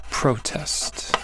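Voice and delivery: whispered protest, PROtest; whispered noun. Shown as a demonstration. Teacher whispering the noun, quiet. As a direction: breathe PROtest while writing. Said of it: "protest and modest".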